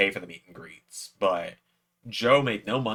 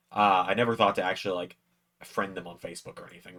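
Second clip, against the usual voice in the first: usual speaking voice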